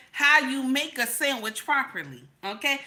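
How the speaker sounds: ordinary delivery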